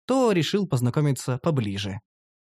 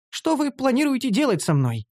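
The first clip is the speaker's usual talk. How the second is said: put-on higher wary elf girl; 8 semitones higher than usual. Captive elf asks her master what he plans, higher and wary.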